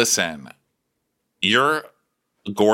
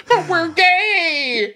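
high, loud, slow tearful plea; hammed-up heartbroken cry; mock wailing; 5 dB louder, much slower than usual. Jilted young boyfriend pleading with his date, high mock wail.

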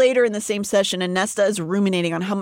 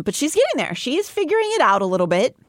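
normal talking voice

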